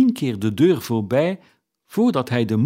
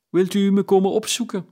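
quick, much higher, pleading lift; eager invitation; 8 semitones higher, faster than usual. A narrator's reading. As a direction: ask it brightly and eagerly.

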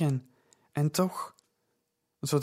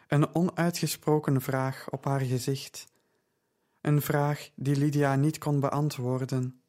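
ordinary delivery, nothing marked

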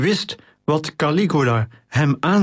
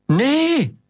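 high, sustained cry of "no"; woman's cry; 13 semitones higher than usual. A narrator's reading. Woman sees a man leap for the ship, crying out. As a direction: call out in alarm on a much higher, held pitch. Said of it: "riep een vrouw".

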